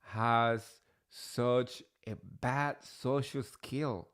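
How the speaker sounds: slow disbelief. Retold or imitated. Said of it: "I was like".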